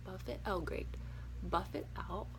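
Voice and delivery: usual speaking voice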